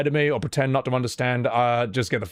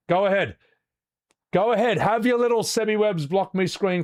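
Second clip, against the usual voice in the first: defiant taunt; 7 semitones higher than usual. Real, not acted. Taunting trolls.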